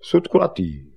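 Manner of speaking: short, punchy declaration; called out. Acted. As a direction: say it out firmly, falling at the end. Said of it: "někomu povídal".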